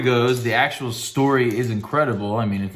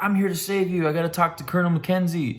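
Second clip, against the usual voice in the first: revived hero; 7 semitones higher than usual. Retold or imitated. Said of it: "he comes back to life and he's like".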